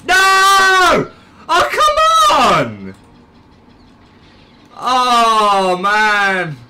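real high, loud wail of dismay; frustrated yell. Real, not acted. Gamer reacting to badly timed mishap, anguished shout. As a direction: cry out in loud disbelief. Botched moment in the game.

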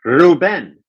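trying out the accented end of "Ruben"; repeating Spanish pronunciation. Shown as a demonstration. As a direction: echo Ruben as roo-BEN. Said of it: "How do you say your first name?".

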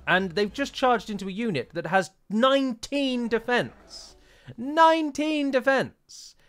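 Nineteen defense stretched, high and slow; nineteen drawn out in outrage; incredulous outrage. Real, not acted. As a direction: hammer the number in disbelief. Incredulous rant at dead unit.

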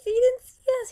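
high thrilled shout; excited squeal. Real, not acted. Excited near-miss.

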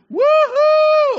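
high, loud cheerleader whoop; cheerleader cheer. Shown as a demonstration. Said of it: "You can go".

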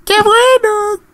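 very high, delighted squeal; gleeful squeal. Real, not acted. Player delighted at the game scene, squealing.

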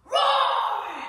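long, very loud, high playful roar; lion roar. Acted. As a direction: roar out the word like a storybook lion. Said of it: "when she saw the lion".

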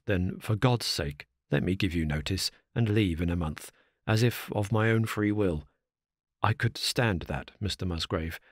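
low, soft, pleading butler; a broken servant's hoarse plea; hoarse with emotion. A narrator's reading. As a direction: choke the words out, hoarse and begging. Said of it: "in a voice which was hoarse with emotion".

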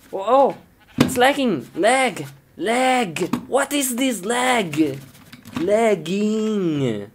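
high, drawn-out whine of frustration; exasperated complaint. Acted. Gamer complaining as the game lags, exasperated. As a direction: wail each word in mounting annoyance.

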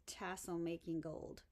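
ordinary delivery

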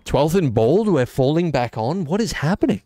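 excited. Real, not acted. Gamer reacting to a baffling turn in the game, excited. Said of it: "Just got me excited".

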